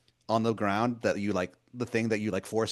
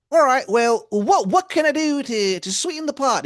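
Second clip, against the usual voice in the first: much higher and louder, a sweet-talking wizard calling you hun; Baltimore wizard voice; 9 dB louder than usual. Acted. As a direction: wheedle like a pushy old wizard begging a favor. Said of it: "He goes".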